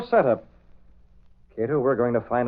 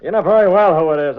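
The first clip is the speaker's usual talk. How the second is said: gruff insistence; 5 semitones higher, 8 dB louder than usual. Acted. [name] poses as mob caller.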